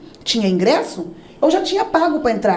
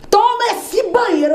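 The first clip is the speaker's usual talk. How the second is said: preacher call; 10 semitones higher than usual. Retold or imitated. Missionary praying.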